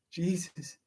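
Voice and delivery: a whispered, shaken exclamation; stunned whisper. Real, not acted. Reader shocked by the story, whispering. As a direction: breathe it out in a hushed whisper. Stunned reaction while reading.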